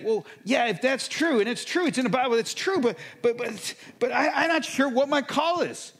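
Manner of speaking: high, loud exasperated complaint; plead, fed up and unsure; mock frustrated protest with a laughing catch. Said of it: "there's this frustration".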